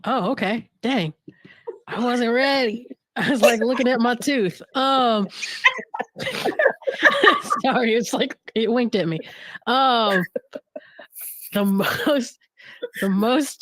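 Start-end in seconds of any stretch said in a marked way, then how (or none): 5.3-7.6 high breathy burst of laughter; a sudden airy giggle; laughing. Real, not acted. Caught looking at her tooth.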